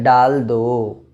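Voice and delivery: डाल दो spelled out slowly, retroflex ड; slow clear example word. Shown as a demonstration. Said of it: "जैसे बोलता है".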